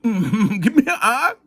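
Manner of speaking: slow chant broken by laughter; laughing cheer. Retold or imitated. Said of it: "spelling out some victory for you".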